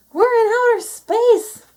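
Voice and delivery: very high, loud, slow cry of wonder; ecstatic shout. A narrator's reading. Minnie shouting in space, amazed and thrilled. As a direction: shout it to the stars. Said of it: "shouted Minnie".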